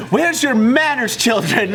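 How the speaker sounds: high, drawn-out schoolmaster rebuke; mock scolding. Friend trying the airport man's line on kids, mock scolding.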